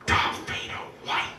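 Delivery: hushed unvoiced kid's murmur; whispered remark. A stunned child murmuring at the unmasked performer, whispered.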